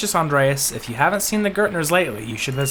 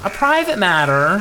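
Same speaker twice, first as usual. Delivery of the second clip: "A private matter?" louder, rising on "private"; surprised repeat; 4 semitones higher, 6 dB louder than usual. Pretend. Echoing the character's question in louder mock surprise. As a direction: throw it back in mock disbelief. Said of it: "repeats line, louder, rising".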